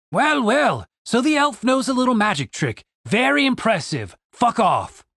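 a contemptuous soldier; sneering soldier. A narrator's reading. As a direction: Julian mocked by the soldier.

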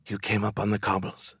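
very soft, hushed fearful question; terrified whisper. A narrator's reading. Woman to fellow cobble-born, terrified of being heard, whispering. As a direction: barely let it out, afraid of being overheard. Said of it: "she whispered as if she was terrified she would be heard".

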